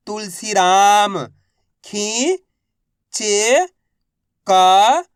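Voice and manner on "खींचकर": राजनीतिक drawn out one syllable at a time. Shown as a demonstration. Teacher sounding out राजनीतिक for learners. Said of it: "तो मिलके बन जाएगा".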